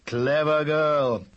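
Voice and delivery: slow, fond father voice. Acted. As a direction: praise her slowly and fondly. Father praises Laura.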